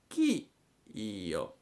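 un accenno di lumaca acuta; voce di lumaca. A narrator's reading. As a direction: domanda lenta e sospesa. La lumaca chiede.

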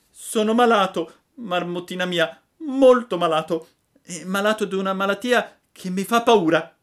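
high, loud frantic puppet voice; a scared puppet wailing to a friend; Pinocchio frightened. A narrator's reading. Pinocchio tells the marmot he is sick, high frightened wail.

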